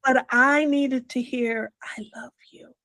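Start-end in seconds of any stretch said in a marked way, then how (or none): 1.8-2.7 softly whispered I love you; hushed whisper. Retold or imitated. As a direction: whisper it, barely audible. Longing to hear mother say it.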